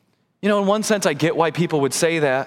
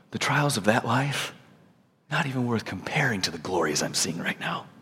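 hushed quote; 7 semitones lower, 6 dB quieter than usual. Retold or imitated. Quoting Paul, fading soft.

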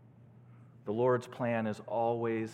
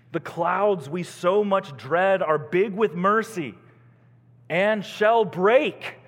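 loud, rising proclamation, slowing at the end; declaimed hymn verse; 8 semitones higher, 10 dB louder, slower than usual. Real, not acted. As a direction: declaim it as a bold promise. Preacher declaims hymn verse to congregation.

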